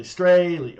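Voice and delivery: emphatic "Straley" with long AY; demonstrated pronunciation. Shown as a demonstration. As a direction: spell out "Straley" by sound, loud STRAY-lee. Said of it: "Oh, Lee, yeah, L E Y".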